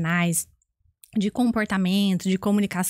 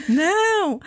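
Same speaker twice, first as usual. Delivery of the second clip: re-enacted high-pitched "no"; emphatic denial; 8 semitones higher, 3 dB louder than usual. Woman at the corner answers the guesser, emphatic denial. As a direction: playfully call back a high denial. She calls back another denial.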